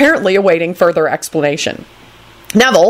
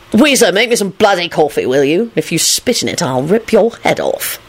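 grumpy Lupin. Acted. Lupin orders coffee from Weezer, grumpy threat.

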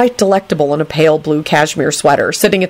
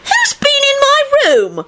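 very high, drawn-out screech; angry screech; slower than usual. A narrator's reading. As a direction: screech it like an outraged girl. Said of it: "Rosie screeched angrily".

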